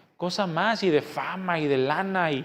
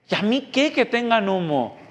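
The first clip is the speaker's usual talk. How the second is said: a sharp, higher "so what" thrown back; dismissive retort; 6 semitones higher than usual. Real, not acted. Preacher dismissing congregant's complaint about smoke, scoffing. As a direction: brush off the complaint, pitched up.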